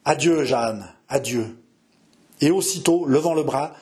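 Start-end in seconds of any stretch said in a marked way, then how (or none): none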